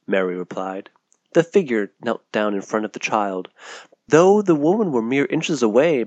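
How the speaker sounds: ordinary delivery, nothing marked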